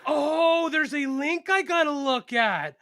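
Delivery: slow, high caricature of realizing; mock dawning realization. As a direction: stretch it out as the penny drops. Sister realizes, in put-on voice.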